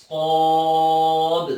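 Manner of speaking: صاد slow and loud, lips rounded, no و sound; loud, slow, heavy صاد; heavy drawn-out letter. Shown as a demonstration. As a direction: stretch صاد long and heavy against the palate. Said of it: "واو کی آواز نہیں آئے گی".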